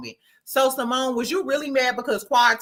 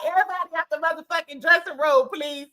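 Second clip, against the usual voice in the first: mock shout; 7 semitones higher, faster than usual. Doctor Jackie clearing the room, mock shout. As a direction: hurry them out. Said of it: "She like".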